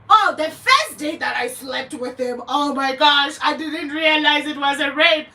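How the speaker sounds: mocking a tearful accuser, half-hearted sobbing; a mild mock yell on "oh my gosh"; mock crying woman. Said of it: "start crying tears like Lady Zama".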